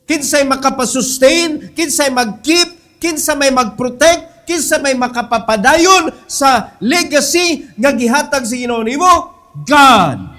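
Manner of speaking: loud, rising preacher's climax; preaching at full force. Acted. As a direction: drive it home to the congregation. (kusog nga pagsangyaw sa pastor)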